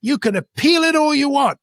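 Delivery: high-pitched imitation of an irate director. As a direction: pull rank, mimicking her indignant high voice. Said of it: "She raises her voice".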